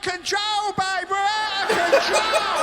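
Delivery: high, loud confessional wail; mock overspending mum. Comic as the mum admitting she's out of control. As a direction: gush it like a guilty mum. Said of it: "she's like".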